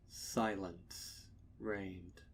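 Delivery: usual speaking voice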